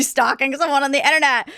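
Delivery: ordinary talk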